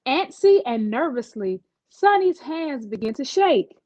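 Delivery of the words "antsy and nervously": usual speaking voice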